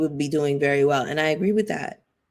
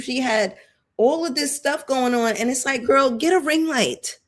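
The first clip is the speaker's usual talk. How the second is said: higher, animated push to just do it; excited urging; 7 semitones higher than usual. Acted. Host urging Wendy onto YouTube, higher and excited. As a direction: hype her up, girl get a ring light.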